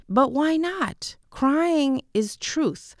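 impassioned slow declaration; emphatic slow. Real, not acted. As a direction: make crying is truth land hard. Passionate point on crying.

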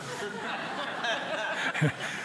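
soft breathy laugh at his own joke; laughing at his own punchline. Real, not acted. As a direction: let out a quiet laugh.

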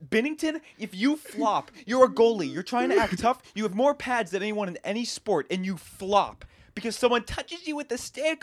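high-pitched indignant tirade; mad rant. Real, not acted. Hockey fan telling off goalie Binnington for flopping, angry rant. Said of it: "I'm gonna be so mad".

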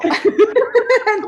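bursting out in high laughter; a big, bright burst of laughter; loud high laugh. Real, not acted. Listener laughing hard at the scary story.

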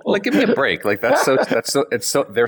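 loud, high, laughing rant; laughing outburst. Real, not acted. Laughing outburst against the French.

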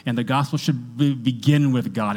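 ordinary delivery

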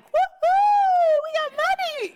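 high, loud whooping glee; hammed-up cheer over a windfall; mock celebration. Mock celebration of found money.

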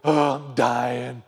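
slow, drawn-out mock dying groan; weak dying moan. Shown as a demonstration. Preacher mocks a feeble dying complaint, drawn out. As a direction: whimper it like a man giving up. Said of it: "Jesus wasn't mincing words like we do".

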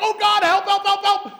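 high, loud cry for help; panicked plea. Retold or imitated.

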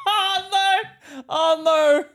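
loud, high, hammed-up wail; mock anguished cries. Friend clowning as the wounded superhero, mock anguished cries. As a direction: cry out in comic despair.

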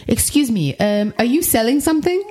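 a hint of a wary French neighbour; imitating a French woman. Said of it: "this French woman".

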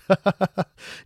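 loud, high mock cackle; put-on burst of evil laughter; imitated villain laughter. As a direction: cackle like a villain who was faking tears. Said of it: "it goes into laughing".

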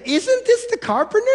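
high, incredulous scoff at the carpenter; scoffing crowd. Retold or imitated. Preacher voicing hometown crowd, scoffing high. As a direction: sneer it like a doubting townsman. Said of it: "Here's what the people say".

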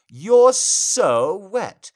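high, loud and drawn out; loud exclamation. Acted. Dominant voice exclaiming.

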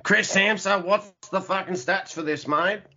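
exaggerated Australian accent; put-on Aussie accent. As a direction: call out to a mate in broad Aussie. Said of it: "putting on a fucking Aussie accent".

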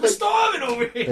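high loud pleading shout; desperate shout. Acted. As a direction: cry it out desperate. Acted desperate villager.

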